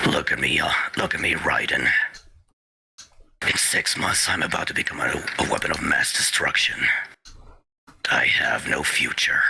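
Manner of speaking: low, gruff, half whispered soldier; Snake voice. Shown as a demonstration. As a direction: growl it low like a grizzled old soldier. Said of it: "my Snake voice".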